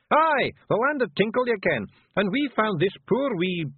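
high, rough little Scottish pictie, gently; a tiny Scots warrior breaking bad news; Feegle voice. Rob bluffs.

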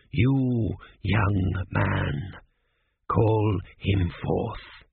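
low, slow command from the dead; ancient mummy. A narrator's reading. Ancient mummy orders the young apprentice, low and slow. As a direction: order it in a deep, dragging mummy voice. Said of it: "said Ashk-ur-men-tep".

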